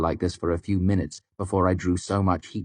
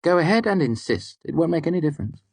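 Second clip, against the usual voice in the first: higher, defiant woman's voice; glaring Devi; 10 semitones higher, faster than usual. A narrator's reading. Devi glaring at the narrator across her desk, defiant. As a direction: dare him to insist. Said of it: "Devi said with a glare".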